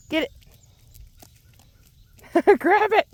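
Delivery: high, laughing coax; coaxing pet call. Real, not acted. Fisherman urging the heron to grab the fish, high coaxing voice. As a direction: urge the bird on sweetly.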